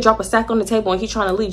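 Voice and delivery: usual speaking voice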